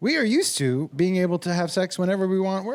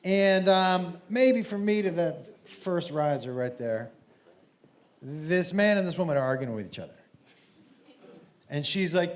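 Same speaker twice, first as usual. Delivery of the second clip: ordinary delivery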